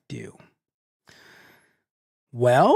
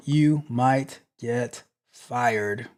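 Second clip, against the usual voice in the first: slow, every word weighed; deliberate slow verdict; slow ominous repeat; 2 semitones lower, much slower than usual. Real, not acted. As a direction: let the warning sink in slowly.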